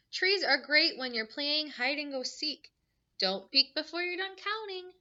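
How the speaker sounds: bright storybook read-aloud; reading to children. Acted. Teacher reading a book to her class, bright and lively.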